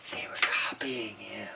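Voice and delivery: hushed, unvoiced whisper; whispered realization. Reader realizes monkeys were copying him, whispering. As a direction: whisper it like a secret revelation. Awed aside to listeners.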